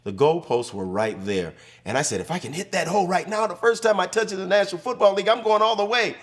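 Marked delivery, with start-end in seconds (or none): 2.2-6.2 much higher and fast, rushing with thrill; a rookie sure he can go all the way; excited retold thought. Retold thought on catching kickoff.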